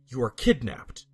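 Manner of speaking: ordinary delivery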